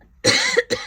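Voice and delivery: loud, rough chesty cough; harsh cough. Real, not acted. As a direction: cough hard from a cold. Ill with a cold, coughing.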